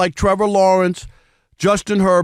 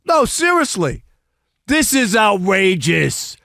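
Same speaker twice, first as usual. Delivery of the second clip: ordinary talk, nothing marked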